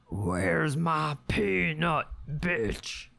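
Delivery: slow tough squirrel voice. Retold or imitated. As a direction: slowly demand the peanut like a thug. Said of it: "that's how I hear them".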